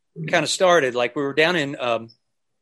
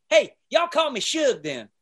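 smart-aleck; 10 semitones higher than usual. Retold or imitated. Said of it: "being a smart ass kinda said".

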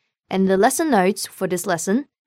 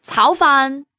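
blunt, curt order; direct; 5 semitones higher than usual. Shown as a demonstration. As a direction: bark it out bluntly. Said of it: "in a direct manner".

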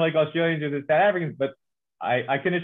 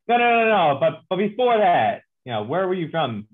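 mock exasperated questioner pressing, higher and louder; imitating the guy who won't believe he's American; incredulous insistence; 4 semitones higher, 4 dB louder than usual. Doubtful fan pressing the American journalist, loud incredulous mock.

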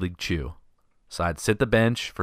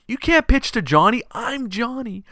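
put-on panicked kid Johnny. Johnny hears the play called, panicked kid voice. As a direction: freak out like a little kid. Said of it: "he's just freaking out".

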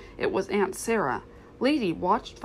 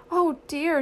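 high, acted wail of distress; sobbed; 8 semitones higher, 4 dB louder than usual. A narrator's reading. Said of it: "sobbed Lady".

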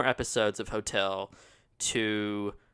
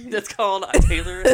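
laughing retort; 9 semitones higher, 11 dB louder than usual. Real, not acted.

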